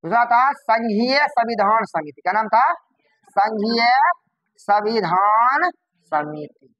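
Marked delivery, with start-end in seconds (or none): 3.3-6.7 drawn-out words with long pauses; dictating. Shown as a demonstration. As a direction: say it word by word for note-takers. Teacher repeats name for class.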